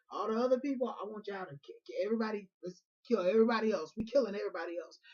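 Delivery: loud, high order to the crowd; mild commanding shout. Retold or imitated. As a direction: bark the command across the camp. Moses ordering the killing.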